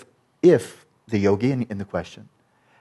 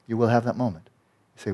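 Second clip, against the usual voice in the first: ordinary delivery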